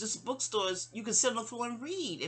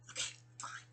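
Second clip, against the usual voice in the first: whispered resignation; 12 dB quieter than usual. Retold or imitated. Retold inner resignation.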